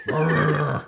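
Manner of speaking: mock growl. Mimicking the hammer guy from the movie, mock growl. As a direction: growl wordlessly like a hammer-swinging heavy. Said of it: "And go".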